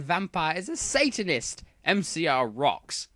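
put-on defensive, huffy teen writer ranting at readers; mock-indignant author's note; whiny teen author. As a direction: snap back at the critics like a touchy fanfic author.

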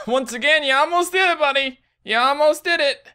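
high, loud, patronising; mock encouragement. Real, not acted. Mocking himself.

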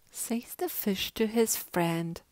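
usual speaking voice

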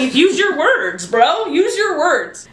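laughing through the words; giggling protest. Real, not acted. Laughing at friend's bait.

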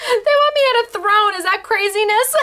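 amused disbelief, laughing it out. Real, not acted. Host tells viewers about the throne, laughing.

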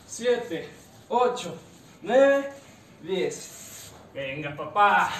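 loud rep count, punchy and clipped; barking gym coach. Acted. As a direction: count the reps like a drill coach. Coach counting reps aloud.

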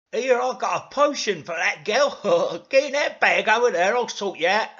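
loud, high, eager character voice; Dougal. Acted. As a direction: hustle someone along with loud confidence. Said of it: "said Dougal".

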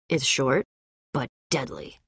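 quiet, low-pitched punchline; low menace. Acted. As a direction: drop low and soft for the threat. Mock threat about own fists.

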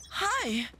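very high squeaky anime voice; anime character voice. Acted. Friend asked to do the anime voice again, high anime voice. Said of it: "Do the voice again".

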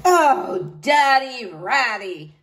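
loud, drawn-out scoffing knight; blustering. A narrator's reading. Knight scoffing at his niece's daddy, blustering. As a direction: bluster with slow, mocking scorn. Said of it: "Sir Horace blustered".

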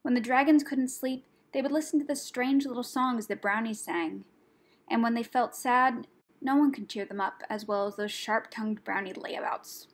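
plain reading voice

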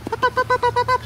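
excited coaxing. Real, not acted. Coaxing the puppy up.

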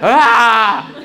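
high, loud squawk; phone shriek. Retold or imitated. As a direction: screech out the garbled phone noise. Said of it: "That was off regular".

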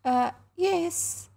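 high, hesitant yes; doubtful. A narrator's reading. Mother hesitating at the visitor's request, high and doubtful. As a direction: agree reluctantly, pitched well up. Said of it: "she said doubtfully".

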